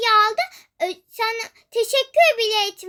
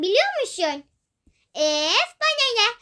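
normal talking voice

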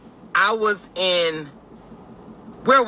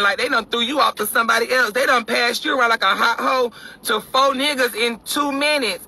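usual speaking voice

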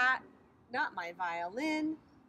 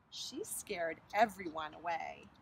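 usual speaking voice

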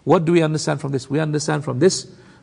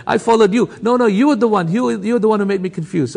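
amused, higher-pitched back-and-forth squabble; mock blaming; 5 semitones higher than usual. Two naughty boys blaming each other, mock squabble. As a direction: bicker in mock protest, half laughing. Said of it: "they're gonna blame each other".

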